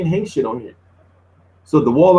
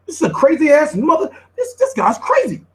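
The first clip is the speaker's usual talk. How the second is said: incredulous amazement; 11 semitones higher than usual. Real, not acted. Streamer reacts to wild clip.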